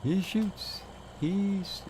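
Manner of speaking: high excited play-by-play call; sports commentator. Pretend. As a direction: call it like a live basketball shot. Tossing rock like basketball shot.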